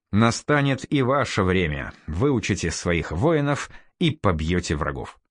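plain reading voice

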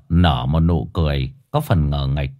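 usual speaking voice

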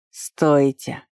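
restrained hoarse plea to stop; a touch of hoarseness; hoarse call. A narrator's reading. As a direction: call out roughly, without much force. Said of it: "хрипло позвал Атли".

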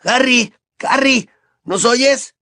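high, urgent call. Acted. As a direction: call through a wall to someone. Calling through a blocked fireplace.

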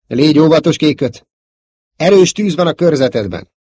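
loud, high-pitched caution; urgent warning. Acted. Commander warning his pilot of heavy fire, urgent. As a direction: warn your pilot over the battle noise. Warning over battle radio.

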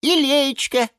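offhand little lemon voice; lightly voiced lemon. A narrator's reading. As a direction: lemon shrugging, offering what he has. Lemon adds.

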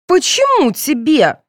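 high, loud, drawn-out challenge; angry. A narrator's reading. Girl to rival contestant over the crown, angry. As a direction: snap jealously at a rival, voice up. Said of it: "разозлилась Соня".

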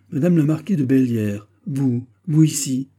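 stunned, slowing down on the last words; astonished. A narrator's reading. Fouquet finds the marquise in his room, astonished. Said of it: "reculant d'étonnement".

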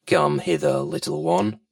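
very low crocodile voice; deep, coaxing reptile; crafty crocodile. Acted. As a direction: drop right down low to lure the little one. Crocodile coaxing the child closer.

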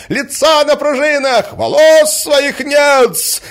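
very high, loud, drawn-out taunt; jeering insult. A narrator's reading. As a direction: expose him with shrieking spite. Women take turns insulting the prince. (злорадно, высоко и громко)

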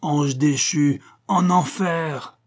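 slow thundering judgment; storm spirit. A narrator's reading. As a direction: boom out the condemnation slowly. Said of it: "la voix retentit encore".

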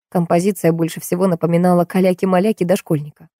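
ordinary delivery, nothing marked

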